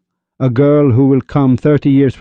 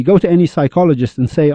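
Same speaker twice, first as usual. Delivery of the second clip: usual speaking voice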